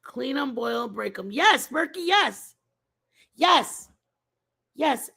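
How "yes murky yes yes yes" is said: excited yes. Real, not acted.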